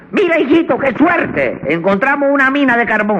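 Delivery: high-pitched mother voice, thrilled; excited mamita. Acted. Defendant quoting mamita finding a mine, high excited voice. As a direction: imitate a mother exclaiming high to her son. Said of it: "Mamita dijo".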